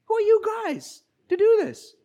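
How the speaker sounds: the council snaps at the apostles, indignant and high-pitched; indignant challenge. Retold or imitated. The Sanhedrin rebukes mere fishermen, indignant and high.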